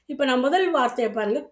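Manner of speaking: ordinary delivery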